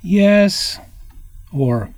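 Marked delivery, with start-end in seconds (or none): 0.0-0.8 Yes, high and louder; bright lifted Yes; eager yes. Shown as a demonstration. Teacher shows one intonation on Yes, high. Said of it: "overlay various intonations on words".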